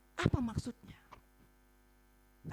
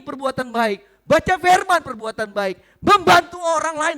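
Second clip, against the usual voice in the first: loud list driven home item by item; rousing preaching; 4 semitones higher, 12 dB louder than usual. Real, not acted. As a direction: hammer each example to the congregation. Preaches at full force. (berkhotbah lantang penuh semangat)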